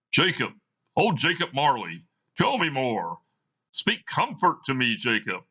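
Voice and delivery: put-on frightened old man imploring his dead partner; pleading Scrooge. Scrooge begs Marley's ghost.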